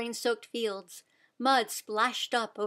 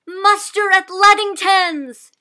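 slow, much louder and higher call; shouting; 9 semitones higher, 14 dB louder than usual. A narrator's reading. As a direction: rouse the whole farm. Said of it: "she shouted".

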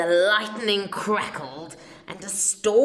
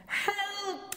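cried; 12 semitones higher, 6 dB quieter than usual. A narrator's reading. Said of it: "the other one cried".

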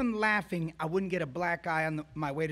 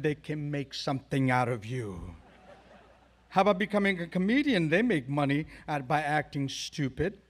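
math teacher. Retold or imitated. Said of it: "who said to me".

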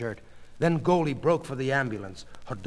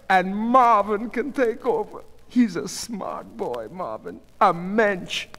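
high, slow, insistent soothing; Goldie, anxiously reassuring wife; 10 semitones higher, 5 dB louder than usual. A narrator's reading. Goldie planning for her stricken husband, anxiously reassuring. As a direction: reassure a sick husband in a raised, drawn-out voice.

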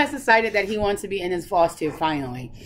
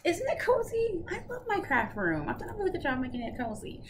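sarcastic; 9 semitones higher, 5 dB quieter than usual. Real, not acted. Teasing partner who took craft room.

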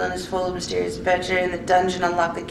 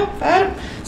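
fed up; 11 semitones higher than usual. Real, not acted. Quitting frustrated game.

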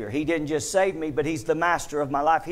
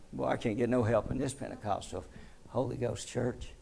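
much softer wry aside; muttered aside; 3 semitones lower, 9 dB quieter than usual. Real, not acted. Preacher grumbling to the quiet congregation, softly wry. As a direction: drop the voice for a joking grumble.

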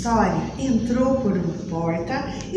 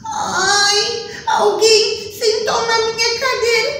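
much higher, near-tears little bear; almost crying; 12 semitones higher, slower than usual. Acted. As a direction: whimper like a cub whose chair broke. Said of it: "Ele quase chorando". (ursinho quase chorando, voz bem aguda)

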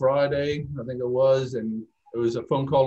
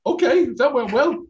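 higher, louder, delighted Irish brogue at own luck; pleased surprise; 13 semitones higher, 6 dB louder than usual. Retold or imitated. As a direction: squeal it like you can't believe it worked. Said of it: "And I was going".

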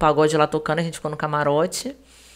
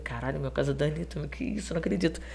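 softer, private exclamation to oneself; inner disbelief; 4 semitones lower, 10 dB quieter than usual. Retold or imitated. Guest recalling hidden shock at visiting [name]'s house, inner disbelief. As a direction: voice a stunned thought under your breath. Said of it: "por dentro eu falei".

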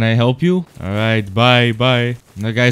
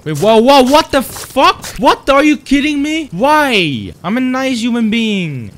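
shocked outburst; 11 semitones higher, 7 dB louder than usual. Real, not acted. Attacked suddenly in the dark.

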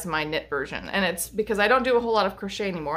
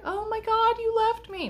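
much higher, panicky little dog voice; voicing the dog. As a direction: play the abandoned old dog. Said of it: "she's like".

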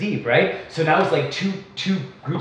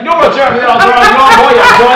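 retold shouting; 6 semitones higher, 11 dB louder than usual. The rival yelling in a quarrel.

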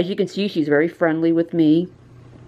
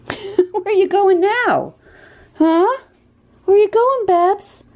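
much higher, louder cooing calls; 13 semitones higher, 3 dB louder, slower than usual. Real, not acted. To the cat Babsy as she wanders off, high baby-talk calls. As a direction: call after the cat, amused.

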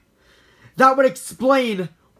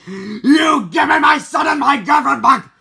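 loud demanding yell; angry shout; 7 semitones higher, 7 dB louder than usual. Acted. Demanding family back.

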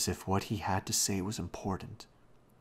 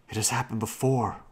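plain reading voice

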